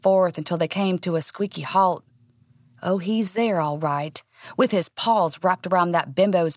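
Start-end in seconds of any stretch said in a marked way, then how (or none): none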